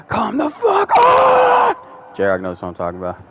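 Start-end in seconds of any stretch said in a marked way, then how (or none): 0.1-1.8 loud, high, drawn-out scream. Retold or imitated. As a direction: imitate a buddy screaming in a rage. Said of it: "would be screaming like".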